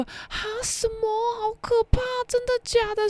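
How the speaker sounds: high, hushed mock horror; high, quiet fake alarm; shocked gasp. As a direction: gasp like a prude pretending to be scared. Shy roommate acts shocked.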